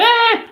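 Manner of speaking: a sudden high "ah!" scream; imitated newborn scream. Acting out a newborn's cry, high scream. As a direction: scream like a baby being born.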